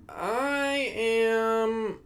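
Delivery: stretched out very slow and high; drawn-out groan of exhaustion. Real, not acted. Tired host whining to listeners, very slow stretched whine. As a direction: drag the words out like you're spent.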